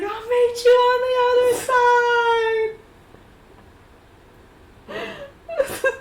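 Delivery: fast, high, loud send-off; calling out. Acted. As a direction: call it across a growing distance, voice soaring up. Calling out to departing Kane.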